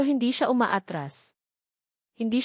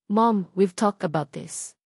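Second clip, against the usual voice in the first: firm reply; 3 dB louder than usual. A narrator's reading. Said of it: "mariing sagot ni Gabriel".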